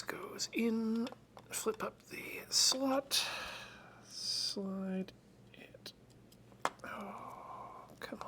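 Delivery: soft whisper under the breath while working; concentrated whisper over fiddly work. Real, not acted. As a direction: whisper to yourself as you fiddle. Quietly fiddling with tiny parts.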